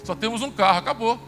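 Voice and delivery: husband telling his wife they are broke; alarmed announcement. Retold or imitated. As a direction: blurt out that it's all gone.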